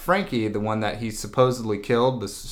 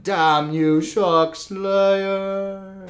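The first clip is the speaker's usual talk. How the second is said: high, drawn-out cry, trailing like a fall; hammed-up curse while plummeting; mock dying curse; 9 semitones higher, much slower than usual. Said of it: "falls into the abyss".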